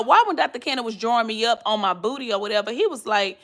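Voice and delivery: usual speaking voice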